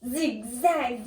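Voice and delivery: plain reading voice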